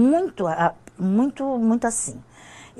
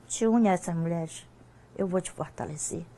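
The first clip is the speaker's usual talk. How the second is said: soft, lower-key quote of God speaking; gentle divine voice, retold; 3 semitones lower, 9 dB quieter than usual. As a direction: quietly relay God's words, trailing softer. Quotes the Lord's voice to her.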